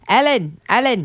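lightly imitated British voice-over; British accent. Quoting a dubbed animal video, lightly British accent. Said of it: "kinda like a British accent".

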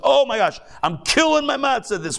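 high, gushing mock boast. Rabbi mocking a proud matzah eater, high gushing boast. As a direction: ham up a smug show-off bragging.

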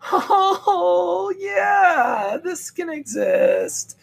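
very high, drawn-out mock wonder; overdone tearful-sounding awe; awestruck realisation. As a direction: coo it slowly, gliding down from a very high pitch. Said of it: "You won't take a second and go".

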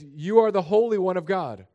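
loud cry, ARE pushed hard; demon crying out. Retold or imitated. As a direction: call it out like the demon. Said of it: "This demon says".

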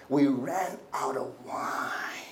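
slow, drawn-out pushy complaint. Acted. Mary pressing Jesus for wine, pushy. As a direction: drag it out, pressing for something to be done. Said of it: "this kind of pushy tendency".

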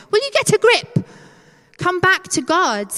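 high, loud exasperated scolding; mock shout. Peace shouting at us to wake up, exasperated. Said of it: "peace needs to shout at us and go".

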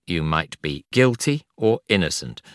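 normal talking voice

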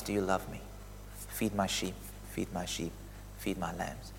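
hushed, loving charge; tender Jesus. Acted. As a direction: speak as Jesus restoring a friend. Jesus voiced tenderly to Peter.

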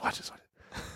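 hushed conspiratorial whisper. Retold or imitated. Dagger nudging his friend before the joke, whispered. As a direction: lean in and whisper it. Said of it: "He goes".

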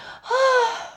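high, gasping sigh; overcome sigh. Real, not acted. Speaker lost for words at how special it is, overcome sigh.

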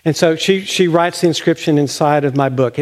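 ordinary talk

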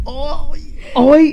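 high, loud mock-English "oi"; hammed-up English yelp; mock English accent. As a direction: parody her strained English accent. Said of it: "in your English accent".

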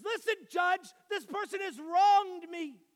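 put-on loud, high and indignant, a small boy demanding justice; the son appeals to a judge over stolen pancakes, loudly indignant; child pleading to a judge. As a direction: plead your case to the judge at the top of your voice. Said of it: "And he says".

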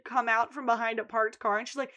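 ordinary talk

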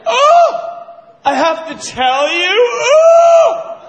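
high, loud indignant shriek; mock outraged. Banker outraged at being asked, high and loud. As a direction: squeal in offended disbelief.

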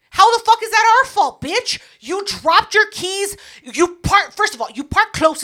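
high, loud indignant rant; mock outraged rant. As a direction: tell him off, voice climbing. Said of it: "ranting at the driver".

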